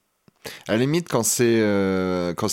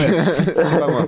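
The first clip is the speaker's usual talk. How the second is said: slow laughing protest, louder and higher; giggling denial; 7 semitones higher, 6 dB louder, much slower than usual. Real, not acted. Laughing to the host that it isn't him.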